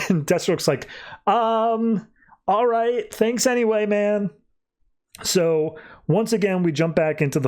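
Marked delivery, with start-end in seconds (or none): 1.3-4.3 higher-pitched, lightly acted puzzlement; mock an awkward, abandoned ally; awkward thanks. Deathstroke reacts to being abandoned.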